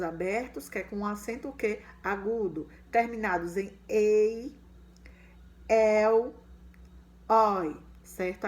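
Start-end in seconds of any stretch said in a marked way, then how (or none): 2.9-7.8 ei, el, oi said louder, each one apart; endings said out loud. Shown as a demonstration. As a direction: stress each ending clearly. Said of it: "ditongos abertos".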